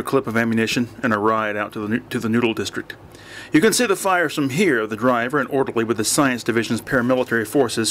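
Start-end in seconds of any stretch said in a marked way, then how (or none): 3.5-4.9 higher-pitched, understated call over a roar; shouted over noise. A narrator's reading. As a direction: call out over blaring music. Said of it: "shouted above the roar".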